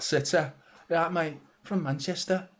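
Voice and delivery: put-on accent that slips into Scouse; wobbly, slightly Scouse imitation; botched accent impression. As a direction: do a bad accent, a bit Scouse. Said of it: "That's a bit Scouse, actually".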